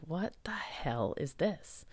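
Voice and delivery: slow unvoiced whisper, muttered to herself; bewildered whisper. To herself, puzzled by week-long nausea: slow whisper. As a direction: whisper it as if you can't believe it.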